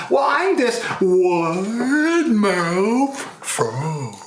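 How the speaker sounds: hugely stretched, high wide-mouthed frog voice; slow, swooping big-mouth frog. Acted. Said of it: "He goes".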